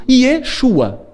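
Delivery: normal talking voice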